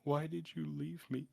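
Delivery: broken, sobbing plea. Acted. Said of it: "in a very, like, broken, sobbing voice".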